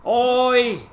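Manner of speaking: oi called out high and loud; calling out. Retold or imitated. Streamer shows how to call someone. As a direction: call to someone across a room. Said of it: "Like to call for someone".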